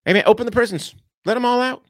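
high mocking voice, lightly done; mock Fetterman. Mimicking a politician freeing prisoners, high and mocking. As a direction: parody a careless politician. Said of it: "He wants to just open up".

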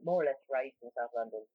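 normal talking voice

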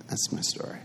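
a soft whispered sign-off; whispered ending. Real, not acted. Closing the story for the audience, whispered. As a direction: breathe it out barely voiced.

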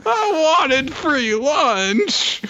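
very high, very slow whimper; hammed-up sad squeak; whiny sulking character. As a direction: drag it out like a pouting kid.

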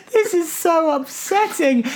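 high, laughing dismay. Real, not acted. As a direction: groan it through laughter. Reacting to revelation laughing.

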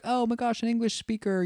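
high-pitched, imitated excitement at no extra volume; mock delighted surprise. Old couple thrilled to meet an English speaker, imitated delight.

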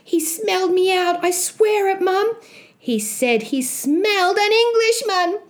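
put-on high-pitched earnest young Jack; insistent boy. Boy swearing to mum he was smelled, high insistent plea.

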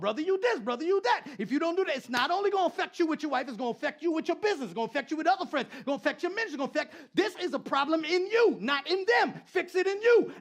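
loud, high preaching cadence; fired-up pastor preaching; preaching at full force. Acted. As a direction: preach it hard to the crowd.

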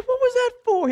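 very high, loud squawk of protest; outraged yell. Retold or imitated. Angler to captain who cut the line, comically outraged yell. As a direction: yell it in hammed-up disbelief.

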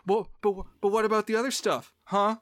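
high, fast mock protest; duped investigators protesting. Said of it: "arguably duped have said subsequently".

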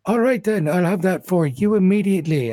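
bartender voice. Acted. Bartender figure continues.

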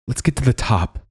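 chattering teeth from the cold. Acted. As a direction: talk through chattering teeth. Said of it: "teeth chattering".

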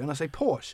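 Porsche said as "porsh"; one-syllable Porsche. Shown as a demonstration. Host defends his consistency, Porsche said porsh. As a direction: drop the final e of "Porsche". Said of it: "I'm just trying to be consistent".

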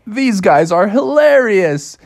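high, drawn-out cartoon delight; amused Bubby. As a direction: relish it slowly as a gleeful cub. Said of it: "Bubby was like".

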